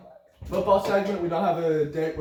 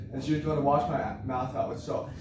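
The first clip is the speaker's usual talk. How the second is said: usual speaking voice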